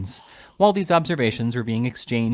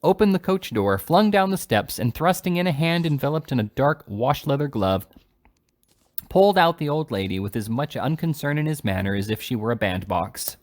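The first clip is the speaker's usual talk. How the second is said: plain reading voice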